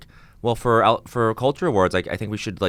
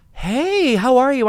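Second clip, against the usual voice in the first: excited greeting; slower than usual. Retold or imitated. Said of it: "I was like".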